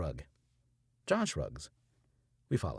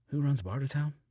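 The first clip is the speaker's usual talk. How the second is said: usual speaking voice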